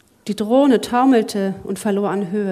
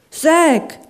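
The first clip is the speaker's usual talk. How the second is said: higher, louder cry of a name; alarmed call; 7 semitones higher, 7 dB louder than usual. Acted. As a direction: call out his name sharply. Lachlan calls out in alarm.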